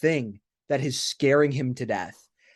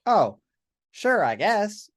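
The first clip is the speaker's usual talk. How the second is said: higher-pitched, noncommittal shrug; unconvinced reaction; 8 semitones higher, 4 dB louder, slower than usual. Retold or imitated. Host acting out a reaction to the brief plotline, lightly unconvinced. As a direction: go along with it, mildly puzzled. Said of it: "it was just something I was like".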